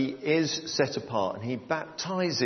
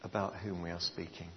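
soft, low closing prayer; quiet prayer; 5 semitones lower, 9 dB quieter than usual. Acted. Pastor closing his prayer to the congregation, soft and prayerful. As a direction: pray it gently to a close.

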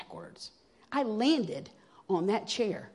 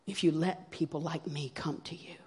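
soft, low whisper; whispered prayer; 3 semitones lower, 5 dB quieter than usual. Retold or imitated. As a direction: retell the plea in a hushed whisper. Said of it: "I came in whispering".

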